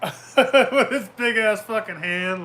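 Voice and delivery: words squeezed out through laughter, slowed; can't stop laughing while saying it. Real, not acted. Laughing while telling wrestling story.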